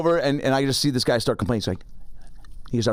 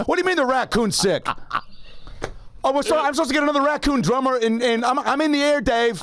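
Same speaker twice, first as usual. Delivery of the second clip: much higher, panicked roadie on the phone; frantic roadie; 13 semitones higher than usual. Retold or imitated. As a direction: whine like a stressed roadie calling Dave. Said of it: "he looked like he was a roadie".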